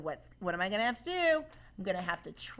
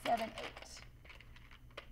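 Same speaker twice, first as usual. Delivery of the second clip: whispered counting; 11 dB quieter, much slower than usual. Real, not acted. Counting rods under her breath.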